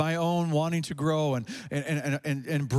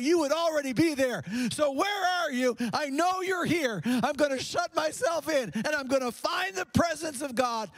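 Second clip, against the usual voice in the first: high, pleading cry to God, building then easing; 10 semitones higher than usual. Acted. Preacher voicing a desperate plea to God in the scary place.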